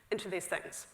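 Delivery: ordinary talk, nothing marked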